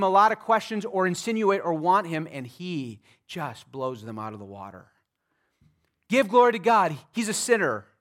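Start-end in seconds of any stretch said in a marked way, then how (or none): 6.1-7.9 louder, higher stern command; Pharisees demanding. Acted. Pharisees ordering the man to give glory, loud. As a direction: bark it like an order from the bench.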